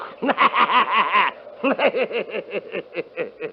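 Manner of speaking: long scornful laugh; mocking laugh. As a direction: laugh the legend off. Kurt laughs off the tale.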